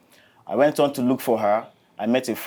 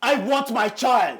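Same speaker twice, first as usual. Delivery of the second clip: desperate shout; 9 semitones higher, slower than usual. Acted. Father demanding his child.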